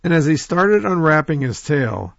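plain reading voice

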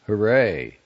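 hollow, half-hearted hooray; loud but empty, falling away; empty cheer. A narrator's reading. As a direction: cheer with nothing behind it. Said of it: "said Whaling emptily".

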